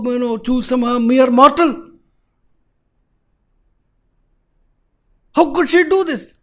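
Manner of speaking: bristling at a mere mortal; outraged Kali. Acted. Kali outraged at Nala's choice.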